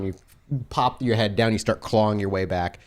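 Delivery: normal talking voice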